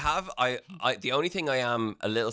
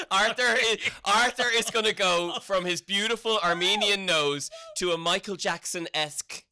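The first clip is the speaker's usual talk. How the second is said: high and louder, teasing; excited; 6 semitones higher, 3 dB louder than usual. Real, not acted. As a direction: tease him, pitched up. Teasing through laughter.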